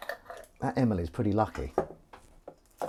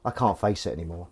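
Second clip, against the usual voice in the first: ordinary talk, nothing marked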